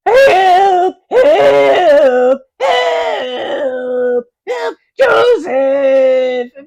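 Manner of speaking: high, loud cries for help; shouting for help. Acted. Drowning angel yells help to heaven, loud and high. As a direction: scream it out from the water, stretching the last call.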